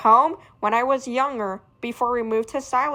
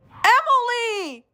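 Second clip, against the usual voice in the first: calling out; 10 semitones higher, slower than usual. Acted. Calling after her.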